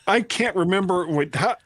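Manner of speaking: ordinary delivery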